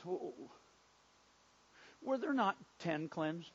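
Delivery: soft, low, puzzled disappointment; gentle, hushed questioning with mild disappointment; disappointed perplexity. Acted. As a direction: ask it quietly, a little let down. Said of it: "with a little disappointment, a little perplexity in his voice".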